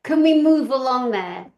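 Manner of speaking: mock-impatient hurry-up; impatient attitude. Viewer chivvies the news channel along, impatient attitude. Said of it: "that's kind of my attitude a little bit".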